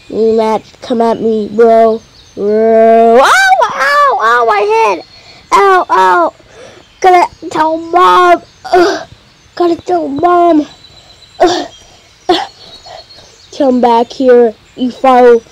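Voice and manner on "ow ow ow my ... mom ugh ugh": put-on high, whining kid crying ow; a bratty kid running to mom; whiny hurt kid. Hurt kid runs to tell mom.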